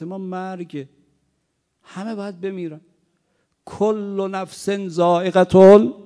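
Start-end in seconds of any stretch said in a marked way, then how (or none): 3.6-5.9 preaching cry. Acted. Quranic verse cried out.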